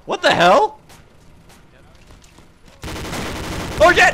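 loud alarmed outburst; startled shout. Real, not acted. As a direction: yell out in sudden shock. Sudden explosion in the game.

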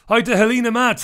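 loud, high sing-song hello; host hailing a listener, loud and bright; cheery greeting. Real, not acted. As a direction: belt out a big welcome to the caller. Radio host greeting caller loudly.

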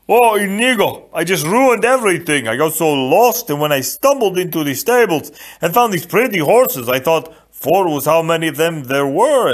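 high, loud, gushing storytelling; Fezzik, eager giant. A narrator's reading. Fezzik tells Inigo how he got lost, gushing loudly. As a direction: pour out the confession in a big simple voice.

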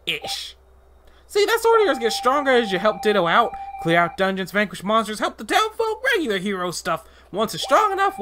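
bouncy little helper creature voice; high sidekick voice. Acted. Sidekick character voice.

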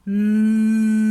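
a loud, sustained nasal "Mm" hum; bear hum. Shown as a demonstration. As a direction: hum "Mm" like a purring bear cub. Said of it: "mruczymy jak misie".

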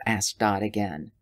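ordinary delivery

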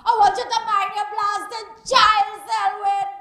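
angry Caribbean woman yelling curses at a man's house; furious cursing woman. Acted. Jilted woman outside Selwyn's house, furious yelling. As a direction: rage at him, loud and raw.

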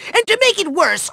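ordinary talk, nothing marked

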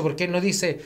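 usual speaking voice

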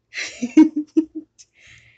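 loud, high outburst of laughing; burst of laughter. Real, not acted. Gamer caught by another player, bursting into laughter.

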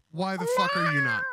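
sudden high yelled question; angry shout. Real, not acted. Yelling at the stupid cat over a debate, furious shout. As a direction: blow up at the cat in a yell.

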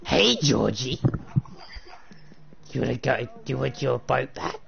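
lightly voiced, slightly low creepy clown; a hint of Pennywise luring Georgie; Pennywise impression. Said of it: "I can do an impression of, um, Pennywise".

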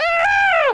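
high, loud scream; loud high yelp; startled cry. Real, not acted. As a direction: shriek out suddenly. Sudden cry in the game.

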